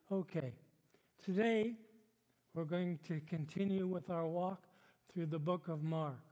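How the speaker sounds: normal talking voice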